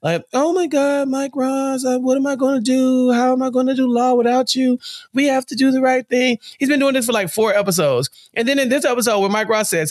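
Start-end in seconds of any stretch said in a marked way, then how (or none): 0.3-6.4 high, whiny helpless plea; mock-panicked Oliver, pitched up; lost deer. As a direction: play him as a frantic lost deer. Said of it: "this lost deer".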